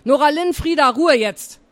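put-on a teacher cutting off two chattering girls; teacher shouting. A narrator's reading.

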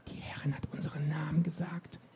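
high, hushed voiceless whisper; starstruck, breath-only whisper; awed whisper. A narrator's reading. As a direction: whisper it in wonder.

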